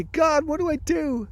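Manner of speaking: high-pitched retold plea to God; desperate calling out. Chased dreamer calls out to God, acted desperate plea. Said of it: "I call out to God".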